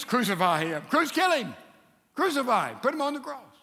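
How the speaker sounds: short bursts, climbing high; imitated mob shouts. The crowd answers Pilate about Jesus, acted angry shouts. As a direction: shout like a crowd demanding death.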